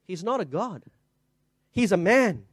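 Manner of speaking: ordinary delivery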